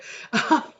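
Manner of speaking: short unvoiced giggle; breathy laugh. Real, not acted. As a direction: laugh at the joke. Laugh after joke.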